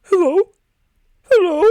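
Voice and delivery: high, loud, startled-sounding hello; shrill-pitched imitation of a woman calling out; old woman calling. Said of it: "this old woman's listening to".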